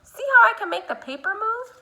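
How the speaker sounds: delighted, show it off; excited. Real, not acted. Excited demo to kids.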